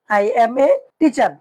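slow, even English; slow model answer. Shown as a demonstration. Teacher models the English reply slowly to the class. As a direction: give each word slowly. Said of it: "আনা মুআল্লিমুন".

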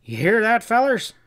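loud, high-pitched holler to the crowd; bellowed. A narrator's reading. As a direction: bellow it out to rouse the men. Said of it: "Tripple bellowed".

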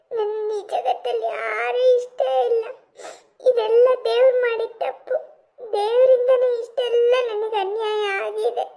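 put-on sobbing child confiding in a parent; halting, tearful despair between sobs; crying. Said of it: "ಯಾಕ್ ಹೀಗ್ ಅಳ್ತಿದ್ಯಾ?".

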